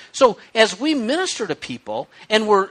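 ordinary delivery

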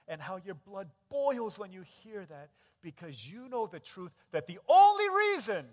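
slow build into a high, full-force shout; impassioned preaching. Real, not acted. Preacher telling the grieving parent of their outrage, impassioned shouting. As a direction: drive each phrase harder, stretching the key words.

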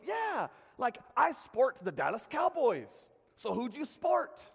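loud, high mock Scottish accent; [name]. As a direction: explain it emphatically as an imitation Scotsman. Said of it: "that Scottish accent".

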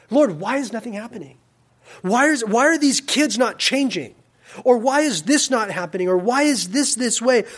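high-pitched, exasperated questioning; frustrated with God. Acted. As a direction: plead upward in mounting mock frustration. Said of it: "get, like, frustrated with the Lord".

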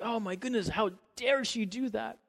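mock indignation. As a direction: act out a scandalized reaction. Acts out shocked onlookers' reaction.

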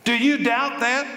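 fiery sermon voice; preaching challenge. Acted. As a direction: throw the question at them. Preaching climax.

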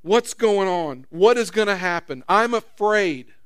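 loud, very slow frightened outcry; mock scream of fear. A person in a storm screams to God, loud slow fear. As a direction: cry it out like someone going under. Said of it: "you want to scream".

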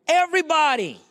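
high-pitched, emphatic burst on the answer; emphatic exclamation. Real, not acted. Pastor answering who was corrupt, high emphatic cry. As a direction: throw the word up high, then let it fall.

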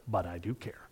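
soft whispered insistence; hushed, heartfelt whisper. Real, not acted. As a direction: drop to a whisper to make it land. Pastor insisting he cares for daughter.

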